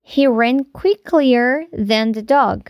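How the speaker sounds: slow, deliberate wrong sentence; slow wrong example. Shown as a demonstration. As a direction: read the mistake slowly for the class. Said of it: "Não dizemos". (frase errada dita devagar)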